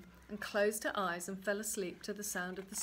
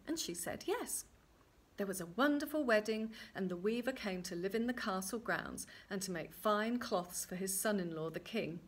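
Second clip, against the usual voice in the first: ordinary delivery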